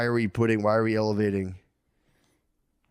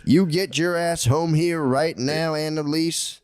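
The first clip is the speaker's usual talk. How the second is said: slow, raised southern drawl; stern southern father; 4 semitones higher, slower than usual. Acted. Father orders daughter Annalise home, stern southern accent. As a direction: order her home like an outraged southern daddy. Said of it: "he's got it way worse".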